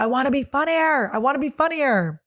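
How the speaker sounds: frustrated. Retold or imitated. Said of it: "I would just be like".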